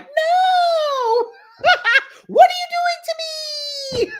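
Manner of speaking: very high, laughing mock shriek of protesting lungs; lungs begging. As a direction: squeal like your lungs are begging for mercy. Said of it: "My lungs are like".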